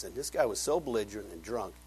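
ordinary talk, nothing marked

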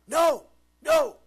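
very high, loud mock tantrum yell; child's screamed refusal. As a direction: shriek it like a stubborn four-year-old. Imitates daughter refusing help with shirt.

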